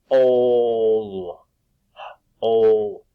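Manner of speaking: "Ol" said loud with a long held "oh"; long o sound. Shown as a demonstration. Teacher sounding out the long o in hole for the class. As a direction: stretch the "oh" in "Ol" out loud. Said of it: "I hear the long O sound".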